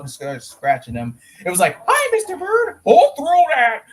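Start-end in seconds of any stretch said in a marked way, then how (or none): 1.9-3.8 high, loud cartoon voice; cartoon character shout. Fan retelling a cartoon scene to friends, high cartoon shout. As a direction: shout it in a high-pitched put-on voice.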